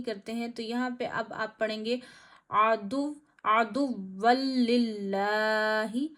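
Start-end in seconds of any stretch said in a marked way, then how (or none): 2.5-6.1 आदुव्वल लिल्लाही with लाम doubled, slow, no nasal; no ghunna. Shown as a demonstration. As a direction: recite आदुव्वल लिल्लाही merged, no stretch. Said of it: "ज़रा भी खींचेंगे नहीं". (आदुव्वल लिल्लाही बिना गुन्ना के)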